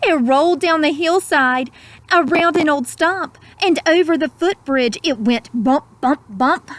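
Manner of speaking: gentle rhyming storyteller; storybook narration. A narrator's reading. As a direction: savour each word like a bedtime story. Rhyming picture-book narration.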